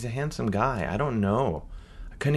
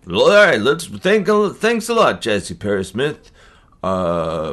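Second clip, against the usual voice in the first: loud, high radio DJ hype; 8 semitones higher, 6 dB louder than usual. Shown as a demonstration. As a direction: boom it out like a radio host. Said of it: "Do you wanna try again?".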